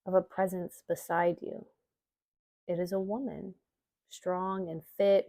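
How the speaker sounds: calm, measured meditation reading with long pauses; guided meditation. Acted. Yoga teacher guiding listeners through an Imbolc visualization, meditation voice. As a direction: guide listeners gently inward, leaving space between phrases.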